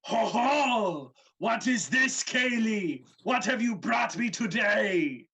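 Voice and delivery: big loud brute, bellowing; roaring ogre greeting; booming giant voice. Acted. GM voicing giant.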